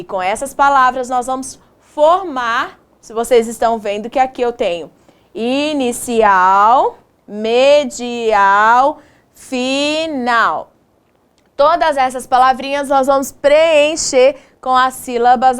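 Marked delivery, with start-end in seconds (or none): 5.3-10.7 slow, syllable-by-syllable teacher delivery; measured, drawn-out syllables; sounding out slowly. Shown as a demonstration. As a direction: stretch each word for young pupils. Teacher spelling out positions.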